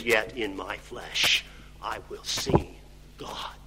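low, soft, sinking to a whisper; hushed reverence. Acted. Preacher reading Job's verse of hope, softly reverent. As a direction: let it fade to a whisper on "God".

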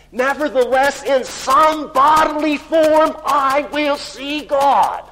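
high, slow, full-voiced shout; triumphant proclamation. Preacher voicing Job's hope of seeing God, triumphant shout. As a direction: proclaim it at full force, each word drawn out.

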